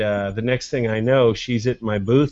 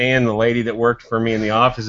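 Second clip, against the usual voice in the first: normal talking voice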